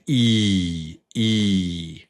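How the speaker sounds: long stretched "E" on its own; long E sound. Shown as a demonstration. Teacher drilling the long E with students. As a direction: hold the "E" long and clear. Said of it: "that go with the long vowel sound".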